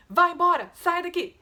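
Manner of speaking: sharp high shout to chase a dog off; shooing the dog. Shown as a demonstration. Teacher shows how you shoo a dog away, sharp loud shout. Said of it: "Se a gente quer mandar o cachorro embora".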